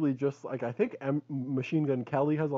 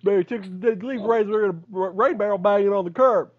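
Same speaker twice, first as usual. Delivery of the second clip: mock Biden story; 6 semitones higher, 5 dB louder than usual. Friend retells Biden's razor story. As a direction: tell it like Corn Pop lore. Biden Corn Pop quote.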